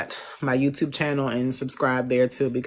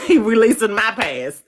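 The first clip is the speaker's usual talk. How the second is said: laughing; 7 semitones higher, 8 dB louder than usual. Real, not acted. Laughing repeat of astrology quip.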